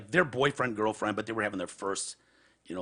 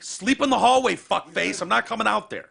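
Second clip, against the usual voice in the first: higher, louder mock yell through the door; retold yell; 9 semitones higher, 6 dB louder than usual. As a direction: bark it at the door, half asleep. Yelling through hotel door.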